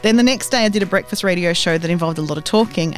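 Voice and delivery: ordinary delivery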